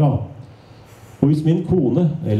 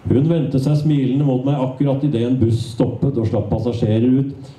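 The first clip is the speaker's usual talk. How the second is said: usual speaking voice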